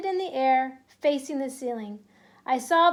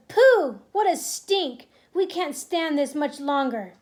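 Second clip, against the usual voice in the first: disgusted cries; 2 semitones higher, 5 dB louder than usual. Acted. Witches smelling the boy, loud disgusted cries. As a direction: yell it while holding your nose. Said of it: "there were cries of".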